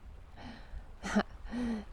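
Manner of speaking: breathy, amused little laugh; soft villain chuckle. Acted. Witch amused by the blushing princess, soft chuckle. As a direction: chuckle softly as if pleased with yourself.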